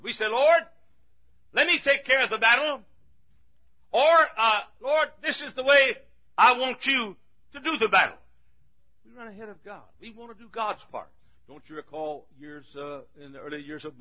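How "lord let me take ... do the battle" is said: high, loud, insistent mimicry; hammed-up pushy plea; mock demanding prayer. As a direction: act out someone bossing God around. Mimics people dictating to God.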